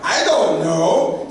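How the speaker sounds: loud, high, drawn-out clueless answer; hammed-up dopey bewilderment; dumb Viking. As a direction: holler it slowly like a baffled oaf.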